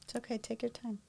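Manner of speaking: very soft, comforting murmur; gentle reassurance. Real, not acted. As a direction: softly give the speaker room to recover. Comforting the speaker who faltered.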